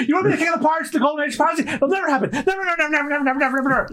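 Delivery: high, frantic repeated nevers; a scared cabin boy rattling off never; panicked Kobe. Acted. Cabin boy Kobe frantically refusing Luffy's pirate dream, high and panicky.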